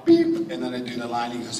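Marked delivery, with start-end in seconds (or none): 0.0-0.5 one high beep tone; a sharp high beep; single beep. Retold or imitated. Imitating the last remaining cue beep. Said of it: "He's like".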